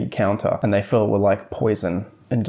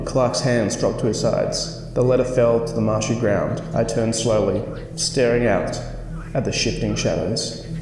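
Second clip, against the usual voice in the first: dramatic story reading. Acted. Reading the book's ending to viewers, suspenseful narration. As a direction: read it like a spooky bedtime story. Reads the book's final scene.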